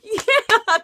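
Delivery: high, loud cheer; cheering coworkers. Retold or imitated. Coworkers cheering her on, loud and high. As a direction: whoop like a cheering crowd. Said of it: "the other ones were like".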